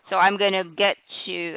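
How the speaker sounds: ordinary talk, nothing marked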